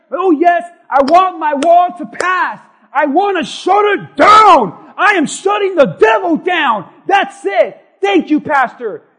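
shouted, high, drawn-out mock enthusiasm; fired-up church member. Pastor imitating his church shouting about shutting the devil down. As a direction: play an overeager congregant yelling back at the pastor. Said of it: "'Cause I know my church".

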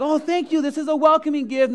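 high and loud, overjoyed; mock delighted. Homeowner thanking the realtor for a chicken, hammed-up delight. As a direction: play the thrilled new homeowner. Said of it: "And like".

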